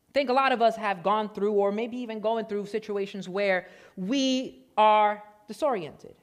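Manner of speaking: ordinary delivery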